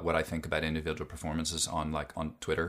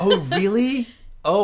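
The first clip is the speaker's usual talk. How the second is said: high, bright surprised "oh really"; genuinely delighted surprise; 13 semitones higher, 10 dB louder than usual. Real, not acted. Excited reaction to an episode mention.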